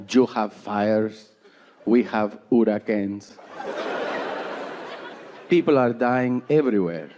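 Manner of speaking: put-on slow, low Peruvian-accented mom; mother's Peruvian accent. Mom in Florida reporting disasters, Peruvian accent. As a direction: imitate a Peruvian mother's grave English, drawn out. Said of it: "she's from South America".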